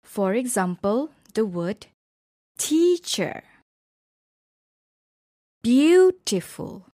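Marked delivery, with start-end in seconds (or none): 2.6-3.4 first syllable of "teacher" punched high; stressed syllable. Shown as a demonstration. Teacher showing word stress, TEA stressed in "teacher". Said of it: "stress is placed on a certain syllable". 5.6-6.8 slow "beautiful" with a loud, high first syllable; stressed syllable. Shown as a demonstration. Teacher showing word stress, BEAU stressed in "beautiful". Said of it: "stress is placed on a certain syllable".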